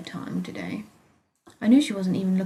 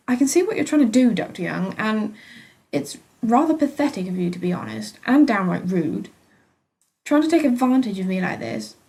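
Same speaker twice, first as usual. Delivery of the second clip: angry snort; 3 semitones higher, 7 dB louder than usual. A narrator's reading. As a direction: scold the doctor with contempt. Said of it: "I snorted".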